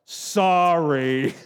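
put-on grudging drawn-out child's sorry; sulky child's sorry. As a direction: pout it out like a kid told to apologize. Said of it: "what did it sound like when they repented".

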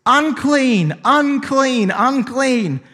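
high, loud repeated cry of unclean; shouted warning cry; yelling unclean. Retold or imitated. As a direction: call it out as a warning to all. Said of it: "you had to yell at the top of your voice".

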